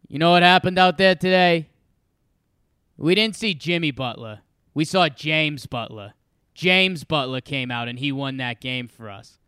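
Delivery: hammed-up Boston, Rhode Island accent, dropping the R's. Sixers coach opening the press conference with a line, mock Boston accent. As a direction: imitate a thick New England coach's accent. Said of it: "it's a Boston, Rhode Island accent".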